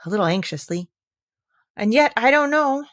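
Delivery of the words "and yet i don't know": careless. Acted. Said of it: "in a careless tone".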